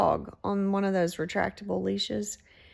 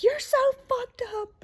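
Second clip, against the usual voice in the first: much higher, yelling insult; mock angry yell. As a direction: imitate him shrieking the insult. Angry man insulting narrator.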